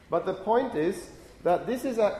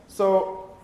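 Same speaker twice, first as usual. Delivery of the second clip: normal talking voice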